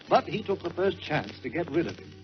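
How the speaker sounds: ordinary delivery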